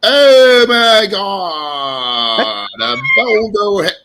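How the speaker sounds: very slow, warped, half-laughing, half-crying wail; dazed Shar impression. Doing Shar struck by a boulder for the panel, slow and warped. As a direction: moan it out like you're concussed and loopy. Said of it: "the Shar impression, if Shar was to get hit by a, a boulder".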